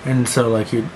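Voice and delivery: normal talking voice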